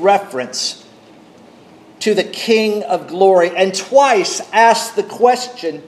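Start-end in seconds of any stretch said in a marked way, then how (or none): none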